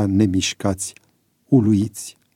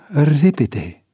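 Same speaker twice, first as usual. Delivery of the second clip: raised, falling urgent word; urgent prompt; 5 semitones higher than usual. A narrator's reading. Valer nudging George to act fast, urgent. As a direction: hurry him along.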